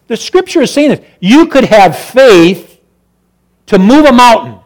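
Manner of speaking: preacher swelling up; rising preaching. Acted. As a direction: build the point with fervour. Preaching about faith.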